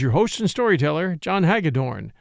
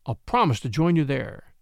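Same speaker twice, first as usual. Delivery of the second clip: ordinary delivery, nothing marked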